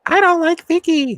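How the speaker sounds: high, loud mocking housewife voice; hammed-up squeaky complaint; mock Bronwyn. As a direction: push it way up high, mimicking a castmate's complaint. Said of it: "Bronwyn was like".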